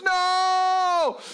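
very high, loud, drawn-out mock scream; imitated kid screaming all the way down. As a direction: stretch it into one long high wail. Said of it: "Screaming the whole two minutes".

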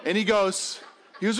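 ordinary delivery, nothing marked

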